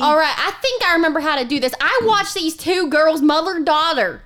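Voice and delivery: bossy Southern granny, rising to a high finish; mean Southern granny. Acted. Granny mocks a podcasting girl, scornful Southern granny. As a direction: play a scornful old Southern grandma.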